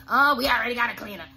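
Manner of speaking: quick, loud brush-off; brushing-off receptionist. Retold or imitated. Receptionist quickly brushing off a cold caller, fast and loud. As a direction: rattle it off like a busy receptionist. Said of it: "if they say".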